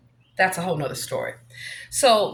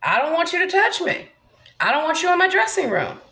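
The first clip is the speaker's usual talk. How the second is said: firm refusal; 6 semitones higher than usual. Retold or imitated. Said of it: "have to deal with telling somebody".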